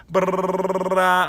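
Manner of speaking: high rolled "brrr" noise; freaky trill imitation. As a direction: trill it to mimic a freaky trance. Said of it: "when he did his freaky".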